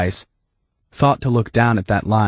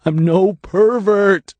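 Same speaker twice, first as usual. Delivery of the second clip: slow, higher, stubborn; insistent denial; 11 semitones higher, 4 dB louder, much slower than usual. A narrator's reading. Dave refusing the label, slow and insistent. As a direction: dig in on the denial.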